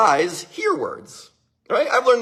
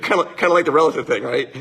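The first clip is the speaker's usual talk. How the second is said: ordinary talk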